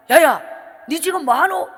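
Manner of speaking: loud startled call in dialect; mother bursts in and yells; mother yelling. Retold or imitated. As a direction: shout it like a shocked mother. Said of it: "이러는 거예요".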